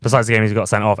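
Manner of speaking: ordinary talk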